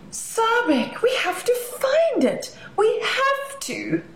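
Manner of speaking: put-on high, gasping, eager child's plea; breathless high excitement with a laugh; urgent excitement. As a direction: gasp and beg to go find it now. Navi urgently wants to find creature.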